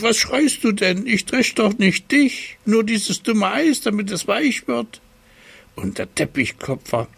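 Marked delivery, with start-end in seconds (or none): 0.0-5.0 hoch und frech rufen; Kasperl-Stimme. Acted. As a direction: verschmitzt übers Geschrei hinwegrufen. Kasperl ruft über Seppels Geschrei. (Kasperl ruft dem schreienden Seppel hoch und frech zu)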